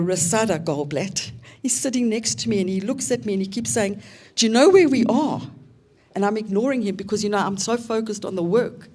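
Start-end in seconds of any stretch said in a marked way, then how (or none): 4.3-5.4 higher and louder, pressing her to notice; urgent eager question. Retold or imitated. Partner nudging her during the session, urgent eager question. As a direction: lean in and urge her to look around.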